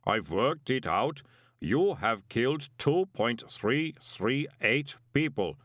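slow, precise inhuman voice; calm golem. A narrator's reading. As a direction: state it calmly, word by word. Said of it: "said the golem calmly".

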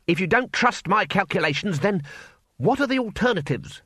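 put-on young wizard snapping at the council, heated; hotly. A narrator's reading. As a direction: defend your calculations with irritation. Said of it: "said Ponder Stibbons hotly".

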